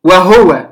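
وهو read as wahuwa with a short u on the ha; وهو pronounced wahuwa, not with a silent ha; vowelled ha reading. Shown as a demonstration. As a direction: say وهو as wa-hu-wa, ha fully voiced. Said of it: "وقرأها البعض".

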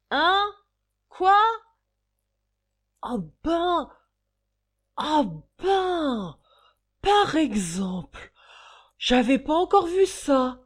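ébahi, très haut et fort; n'en croit pas ses yeux; stupéfait. Acted. Garçon stupéfait devant la scène. (stupéfaction criée)

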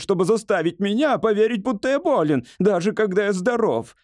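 high, climbing voice of complaint; ranting hypochondriac. Acted. Patient complains to doctor about wife, ranting.

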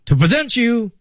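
"To present you" as a short high phrase; 停顿示范. Shown as a demonstration. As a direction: announce "To present you" high, leaving it hanging. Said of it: "停顿". (高声调读“To present you”，读完停顿)

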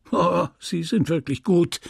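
high, lightly amused mockery; a touch of scornful mirth; amused reply. A narrator's reading. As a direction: answer as if laughing at them. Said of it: "antwortete er lachend".

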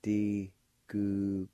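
"Digugtote" slowly, in separated syllables; measured, slow "Digugtote" for learners to copy; slow pronunciation. Shown as a demonstration. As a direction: sound out "Digugtote" with a G almost like K. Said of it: "like this".

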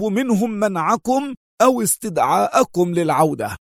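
the consul refuses outright in fury; furious cry. A narrator's reading. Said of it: "صرخ القنصل في ثورة".

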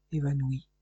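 plain reading voice

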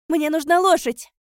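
high, very loud demand; urgent shout. A narrator's reading. Narrator grabbing friends' sleeves for a horse, urgent shout. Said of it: "крикнула я".

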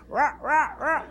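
high, bright little croaks; high frog croaks. Acted. Frogs under the rail greeting the skating frog, high croaks. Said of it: "le decían".

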